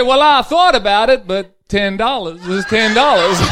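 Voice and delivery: high, loud, matter-of-fact reply; deadpan punchline husband. Acted. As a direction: deliver the punchline straight as the husband. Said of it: "He said".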